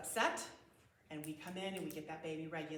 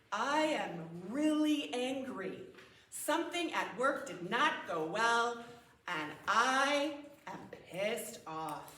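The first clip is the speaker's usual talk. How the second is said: acted anger; 4 semitones higher, 8 dB louder, much slower than usual. Acting out an angry partner.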